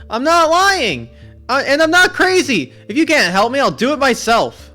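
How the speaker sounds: furious high shout. Acted. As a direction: shout your denial, stretching each word. Max refuses to be called liar.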